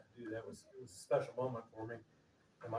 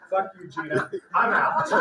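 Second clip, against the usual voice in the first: loud, laughing mock brush-off; telling his cousin off through loud laughter; laughing retort; 7 semitones higher, 12 dB louder than usual. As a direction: bark it through laughter, teasing.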